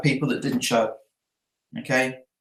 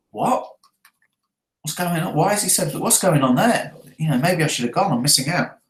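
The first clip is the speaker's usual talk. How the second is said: higher-pitched baffled questioning; mock puzzlement, pitch swooping up and down; puzzled prospect; 3 semitones higher than usual. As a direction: act out a prospect fretting he's missing out. Voices Bill reacting to being dropped.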